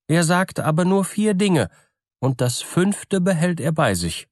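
Bürle, sly peasant. Acted. Bürle tells the miller about his soothsayer, high character voice. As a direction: pitch it high, laying out the terms.

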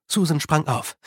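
ordinary delivery, nothing marked

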